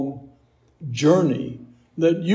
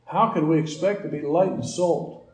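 ordinary talk

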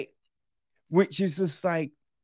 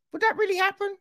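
much higher, doubting question; incredulous question; much faster than usual. Retold or imitated. Viewer doubting a plot moment, much higher incredulous. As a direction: pitch it way up in disbelief. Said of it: "you're like".

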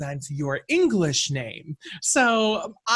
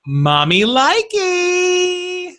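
high, very slow, loud squeal; high excited squeal; 13 semitones higher, 6 dB louder, much slower than usual. Shown as a demonstration. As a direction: stretch it out in a giddy squeal. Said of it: "I'm gonna deliver it as Jim Lanahan".

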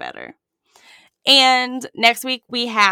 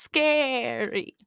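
slow spooky drawn-out ghost; cartoon ghost voice; 4 semitones higher, 4 dB quieter than usual. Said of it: "I'm trying to sound like a cartoon ghost".

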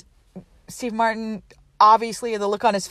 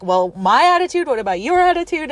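louder, rising mock indignation; indignant retort; 6 semitones higher, 5 dB louder, faster than usual. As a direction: throw it back, pitched up on YOUR attitude. Retold argument with flight attendant.

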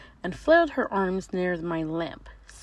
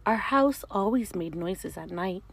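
plain reading voice

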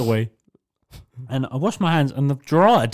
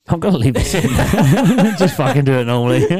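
talking through laughter; laughing; 4 dB louder than usual. Real, not acted. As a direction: laugh while you speak. Laughing at failed attempts.

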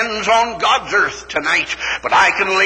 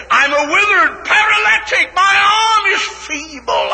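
pleading shout; 12 semitones higher than usual. Acted. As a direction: plead your weakness aloud. Voicing the doubting listener.

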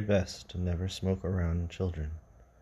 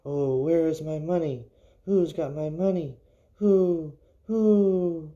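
high, wailing ghost of a dead woman; eerie ghost wail, climbing higher; ghostly wailing voice; 8 semitones higher, 3 dB louder, slower than usual. Acted. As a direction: call out searching for her money. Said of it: "the dead woman cried".

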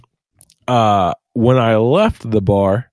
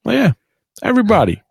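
excited; 8 semitones higher, much faster than usual. Retold or imitated. Bragging about buying the whole bar a round.

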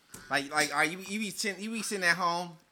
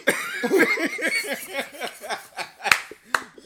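long, loud high laugh; uproarious high laughter; real laughter; 7 semitones higher, 6 dB louder than usual. Real, not acted. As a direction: burst out laughing at a friend. Cracking up after calling friend out.

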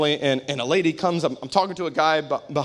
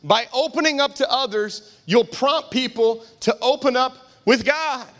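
raised, emphatic preacher voice; preaching; 9 semitones higher than usual. Real, not acted. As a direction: drive each line home to the congregation. Preaches at full force.

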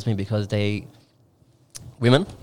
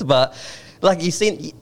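ordinary talk

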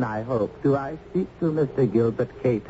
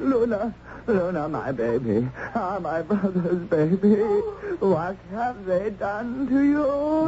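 emotional uncle; 7 semitones higher than usual. Acted. Uncle embraces his rescued niece.

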